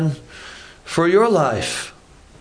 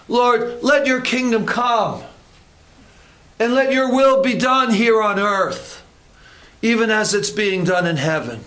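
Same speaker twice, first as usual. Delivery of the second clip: higher, solemn prayer voice; 4 semitones higher than usual. Acted. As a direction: pray it out solemnly to the Lord. Reciting the prayer.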